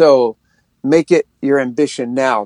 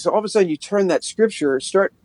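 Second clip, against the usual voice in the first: ordinary talk, nothing marked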